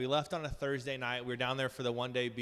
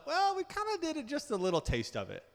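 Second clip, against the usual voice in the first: high-pitched, singsong hedge; playful hedging; 13 semitones higher than usual. Acted. Answering a doubting listener, playful high hedge. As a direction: squeak out a coy qualification.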